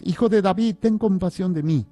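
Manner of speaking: high, loud beggar's plea; acted insistent shouting. A narrator's reading. Reading the blind man defying the scolding crowd, shouting insistently. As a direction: keep crying out over those hushing you. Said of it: "pero él gritaba mucho más".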